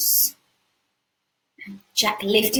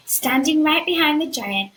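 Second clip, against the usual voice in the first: ordinary talk, nothing marked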